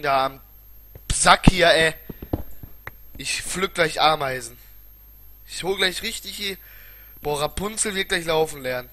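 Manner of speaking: loud, exasperated rant; angry ranting. Real, not acted. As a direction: let the frustration out loud. (lauter, genervter Wutausbruch)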